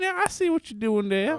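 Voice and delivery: cowboy voice. Pretend. Cowboy Tim.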